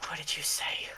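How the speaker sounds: hushed, low threat; menacing whisper. Acted. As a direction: whisper it as a quiet challenge. Miracle menacingly confronts Calamity.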